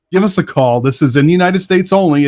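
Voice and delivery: normal talking voice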